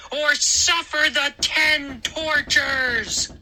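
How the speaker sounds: high, slow, drawn-out threat; menacing threat. Acted. Moose leaning over the boy, slow high threat. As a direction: stretch each word out menacingly, pitched high. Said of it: "leaning over me".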